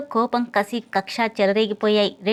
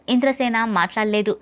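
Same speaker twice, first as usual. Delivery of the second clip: usual speaking voice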